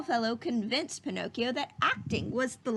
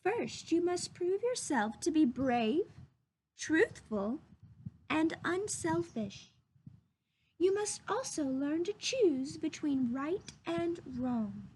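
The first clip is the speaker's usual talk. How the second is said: fairy voice; 4 semitones higher than usual. A narrator's reading. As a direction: guide him gently as the fairy.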